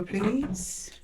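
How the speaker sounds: ordinary talk, nothing marked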